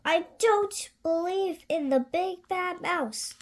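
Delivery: lightly voiced child. Gruffalo's child scoffing, lightly high. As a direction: scoff at the idea.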